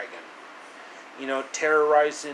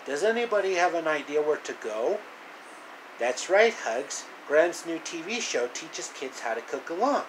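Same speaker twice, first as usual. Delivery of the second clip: usual speaking voice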